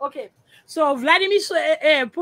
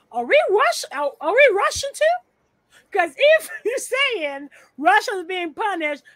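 put-on child voice; 6 semitones higher, 4 dB louder than usual. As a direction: play the puzzled child asking his daddy. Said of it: "So the child's like".

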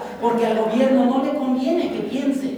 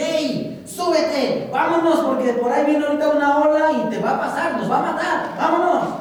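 higher, laughing call to hurry; urgent rescuer; 4 semitones higher than usual. Acted. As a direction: urge him into the boat before the wave. Rescuers urging him to flee. (rescatista apurado, más agudo y entre risas)

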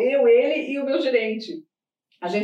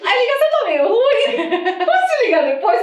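laughing fake excuse; 8 semitones higher, 6 dB louder than usual. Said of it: "faking a bad phone line".

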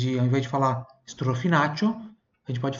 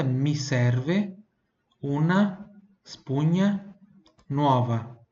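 slow model phrase; much slower than usual. Shown as a demonstration.

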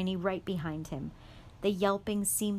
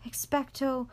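usual speaking voice

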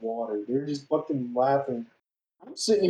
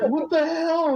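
shocked outrage; slower than usual. Retold or imitated. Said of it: "she was like".

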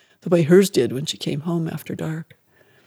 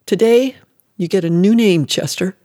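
a mother looming over her small son, scornful and louder; mother's cold command; 3 semitones higher, 6 dB louder than usual. Acted. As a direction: bark the new name at the boy like a cruel mother. Mother cruelly renaming her son.

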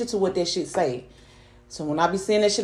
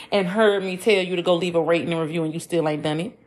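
normal talking voice

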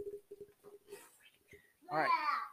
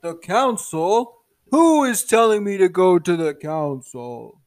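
loud, drawn-out kingly challenge; giant turtle king; 10 dB louder, slower than usual. Acted. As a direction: question them loudly as a ponderous turtle king. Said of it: "the giant turtle king".

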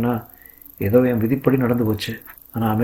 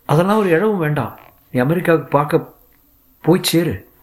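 exasperated refusal; 6 semitones higher than usual. A narrator's reading. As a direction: cut him off, exasperated. Ahalya dismisses his offer.

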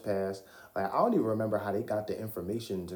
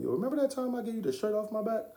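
high-pitched needling callback; teasing, high-voiced boast; mock nagging reminder; 12 semitones higher, faster than usual. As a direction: rub the favor in, pitched way up. Needling a friend about past favor.

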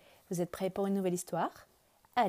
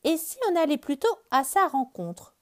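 high, louder cheerful bird voice; bird suggesting; 8 semitones higher, 6 dB louder than usual. Acted. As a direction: offer the idea brightly as the bird. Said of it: "propose Oiseau".

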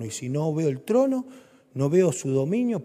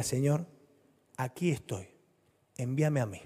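softer, humble prayer; quiet surrender; 3 semitones lower, 7 dB quieter than usual. Acted. Believer quietly telling the Lord to send them. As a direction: offer yourself quietly to the Lord.

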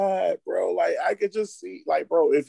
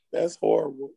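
usual speaking voice

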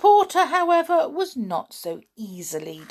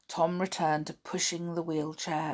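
plain reading voice

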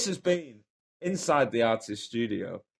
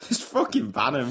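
mock outrage; 13 semitones higher than usual. Mock outrage at quiz answer.

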